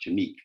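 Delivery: Jameek said juh-MEEK; name pronunciation. Shown as a demonstration. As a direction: pronounce Jameek with a long ee, stressed at the end. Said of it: "how do you pronounce your first name".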